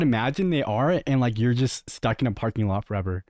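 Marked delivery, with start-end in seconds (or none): none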